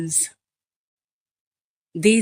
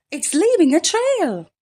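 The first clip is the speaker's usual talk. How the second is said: put-on much higher child's cry; children calling; 9 semitones higher, 3 dB louder, faster than usual. A narrator's reading. Children call out to the teacher, high. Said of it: "say the children".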